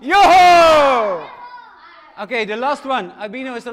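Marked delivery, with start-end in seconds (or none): 0.0-1.3 loud high yo-ho cry; superhero shout. Acted. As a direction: belt out a heroic yell. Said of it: "Three, two, one".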